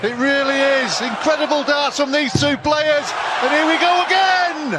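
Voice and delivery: erupting commentator shout; excited shout. Real, not acted. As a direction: roar it out as the darts land.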